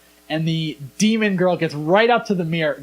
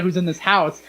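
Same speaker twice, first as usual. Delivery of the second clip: normal talking voice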